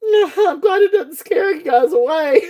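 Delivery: high voice bursting into laughter; real laughing. Real, not acted. Seller relieved viewers aren't scared away, laughing hard. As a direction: squeeze the words out through laughter.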